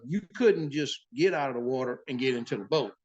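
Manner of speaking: ordinary talk, nothing marked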